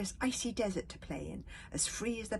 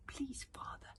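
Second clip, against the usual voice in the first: put-on frightened child's whisper into the dark; 8 semitones higher, 8 dB quieter than usual. A narrator's reading. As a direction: barely breathe the plea. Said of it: "Bear Cub whispered".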